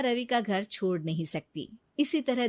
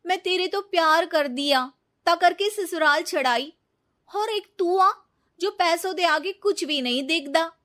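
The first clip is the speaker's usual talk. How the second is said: higher, louder, aggrieved complaint; hurt reproach; 9 semitones higher, 5 dB louder than usual. Acted. Neeta reproaching Ravi, hurt and bitter. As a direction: accuse him of loving only money.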